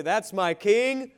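proud declaration. Shown as a demonstration. Preacher urging believers to proclaim their King, slow loud declaration.

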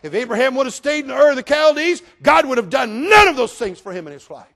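loud, high pulpit shout; full-force preaching. Real, not acted. Preacher to congregation on Abraham's obedience, full-force preaching. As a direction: thunder it out to the congregation.

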